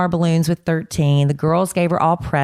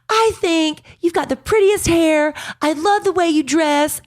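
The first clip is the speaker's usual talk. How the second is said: high, sugary imitation of a genuine teen; lightly voiced sincere girl, high and bright-toned; sweet girl voice; 12 semitones higher than usual. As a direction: gush each compliment like a sweet girl. Same girl complimenting again.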